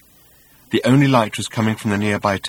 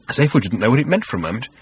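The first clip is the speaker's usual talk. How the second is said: plain reading voice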